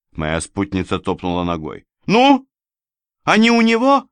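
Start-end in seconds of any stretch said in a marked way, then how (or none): 2.0-4.1 very high, loud questioning; impatient demand. A narrator's reading. Companion pressing the narrator about the drawings, impatient demand. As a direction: demand an answer at once, voice raised high.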